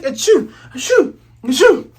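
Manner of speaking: high, loud hammed-up sneezes; mock sneezing. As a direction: fake big cartoon sneezes. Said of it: "He's like".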